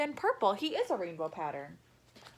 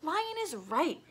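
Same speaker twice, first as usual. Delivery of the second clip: hissing snake; 6 semitones higher, slower than usual. Acted. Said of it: "hissed the snake".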